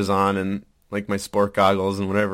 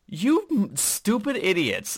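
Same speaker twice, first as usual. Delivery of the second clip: mock scolding; 10 semitones higher, slower than usual. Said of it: "I'm like".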